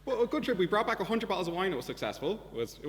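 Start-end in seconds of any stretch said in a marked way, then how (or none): 0.1-1.7 upbeat wrap-up. Real, not acted. Cheerful wrap-up of the story.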